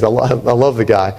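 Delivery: ordinary talk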